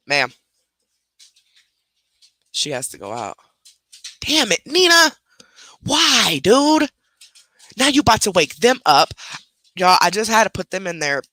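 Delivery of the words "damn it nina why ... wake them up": high, loud frustrated yelp at the dog; exasperated outburst. Real, not acted. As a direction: snap at the dog in real frustration. Dog about to wake puppies.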